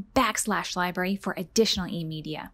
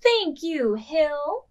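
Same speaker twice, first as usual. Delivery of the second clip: higher and louder, sledding joy; excited; 6 semitones higher, 4 dB louder, much slower than usual. A narrator's reading. Librarian voicing the girl thanking the hill, excited. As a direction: exclaim it gleefully. Joyful refrain in children's book.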